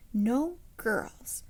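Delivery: plain reading voice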